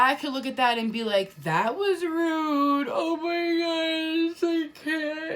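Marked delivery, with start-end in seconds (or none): 1.4-5.4 high, drawn-out whiny fake cry; mock wailing. Playing the victim of a slap in the face, hammed-up wail.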